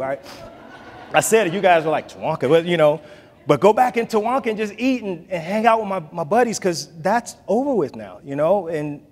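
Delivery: ordinary delivery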